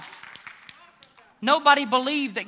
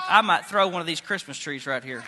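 ordinary talk

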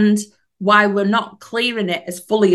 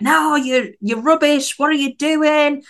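higher, sneering devil voice; mocking devil; 7 semitones higher than usual. The devil on your shoulder belittling you, sneering. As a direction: scoff like a nagging little devil. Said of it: "But the devil's like".

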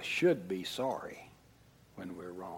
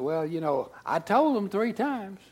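higher, louder protesting whine; defensive excuse; 9 semitones higher, 6 dB louder than usual. Retold or imitated. Dad arguing with the Holy Spirit. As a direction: argue back defensively. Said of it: "And I said".

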